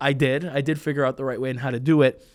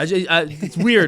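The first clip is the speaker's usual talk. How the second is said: usual speaking voice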